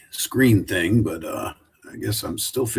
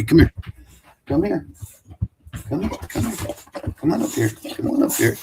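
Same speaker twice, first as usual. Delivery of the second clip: high sing-song come here, pitched up; much higher, cooing at the pet; coaxing dog call; 8 semitones higher than usual. Real, not acted. Calling the dog up to him.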